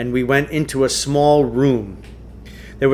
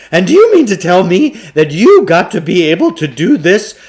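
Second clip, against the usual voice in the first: higher, louder breathless showman; excited huckster; 5 semitones higher, 7 dB louder than usual. A narrator's reading. Shopkeeper bragging about his Tibetan machine, high excited pitch. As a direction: spin a wild tale like a carnival barker. Klugarsh voiced in story.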